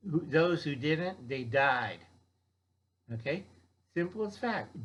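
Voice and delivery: ordinary talk, nothing marked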